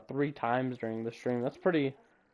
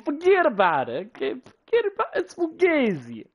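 mock New York mobster; 10 semitones higher, 3 dB louder than usual. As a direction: toss it off like a Brooklyn gangster. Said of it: "all I gotta say is".

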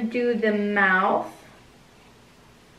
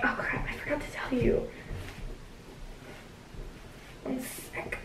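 whispered aside; 15 dB quieter than usual. Real, not acted. Quick aside away from camera.

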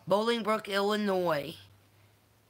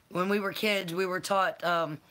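ordinary talk, nothing marked

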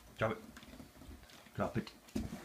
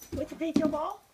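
much higher, louder sing-song question to the pet; 5 dB louder, faster than usual. Real, not acted. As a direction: coo at the pet in a high squeaky voice. Baby-talking to the pets.

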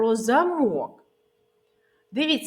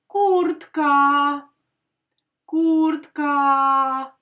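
Куртка raised and louder, syllable by syllable; drawn-out syllables; 5 semitones higher, 6 dB louder, much slower than usual. Shown as a demonstration. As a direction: sing out Курт-ка slowly, both vowels held. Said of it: "І визначимо наголос".

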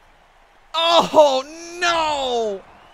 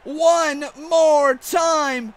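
excited wrestling commentator calling the action; hyped ringside play-by-play; play-by-play commentary; 4 semitones higher than usual. Acted. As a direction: call the match like a ringside announcer.

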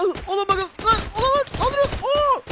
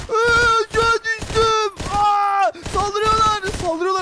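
mock panic shout; 6 semitones higher, 4 dB louder, slower than usual. Character attacked by mobs.

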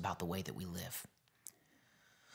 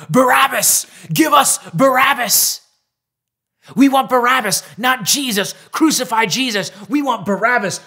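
much louder and higher mob chant; crowd shouting; 14 semitones higher, 15 dB louder than usual. Retold or imitated. Crowd shouting at Pilate for Barabbas, loud mob yell. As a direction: bellow it like an angry crowd demanding Barabbas. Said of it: "They shouted".

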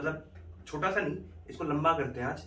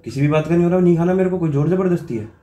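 louder, irritated denial; mock anger; 4 semitones higher, 9 dB louder than usual. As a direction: insist you're not angry while sounding angry. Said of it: "किस बात का गुस्सा निकाल रहे हो खाने पे?".

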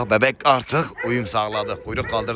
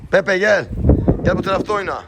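calling the dog; 9 semitones higher, 4 dB louder than usual. Real, not acted.

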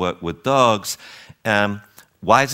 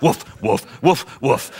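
mock barking. Mocking owner leading dog barking.

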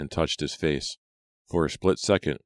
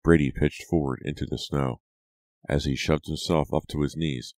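usual speaking voice

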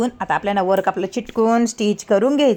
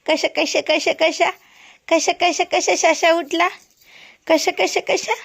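put-on high, sing-song baby talk; mother cooing to her sleepy toddler, high sing-song; baby-talk singsong; 8 semitones higher than usual. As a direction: coo at the waking toddler.

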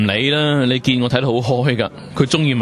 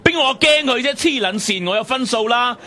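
high, heated, swearing tirade; angry quarrel. Acted. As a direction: lash out in a raised pitch.

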